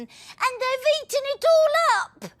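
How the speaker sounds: put-on very high child's voice; little child voice. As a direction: squeak it like a small upset child. Little person complains.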